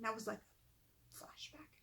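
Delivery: slow whispered aside to the listener; hushed, slow aside breaking from the story. Real, not acted. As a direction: drop to a whisper for a side note. Reader's aside about the story.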